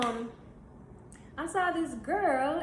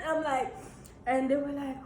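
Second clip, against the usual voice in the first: ordinary talk, nothing marked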